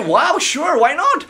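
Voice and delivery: high, bright surprised agreement; delighted yes. Retold or imitated. Passenger accepting the driver's joke, high and excited. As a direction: jump at the offer of a joke.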